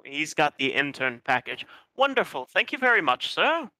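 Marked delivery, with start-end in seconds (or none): 1.9-3.7 high, sugary thank-you; fawning innkeeper. Acted. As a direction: gush with servile thanks.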